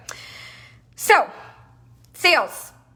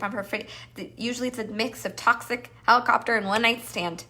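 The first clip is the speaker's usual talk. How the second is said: ordinary delivery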